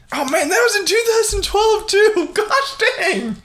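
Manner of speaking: high-pitched laughing disbelief; amazed realization. Real, not acted. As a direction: exclaim it as if it just hit you. Realises how recent it was.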